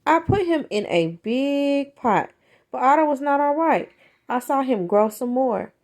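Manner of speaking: ordinary delivery, nothing marked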